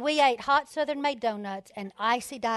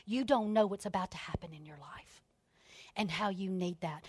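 soft, lower whisper; hushed earnest whisper; 6 semitones lower, 9 dB quieter than usual. Real, not acted. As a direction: draw them in with a whisper. Intimate appeal to listeners.

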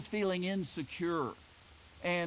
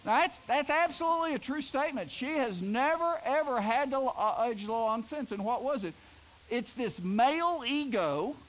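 high, louder emphatic insistence; 7 semitones higher, 3 dB louder than usual. Real, not acted. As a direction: raise the voice high to drive the point home. Emphatic raised preaching aside.

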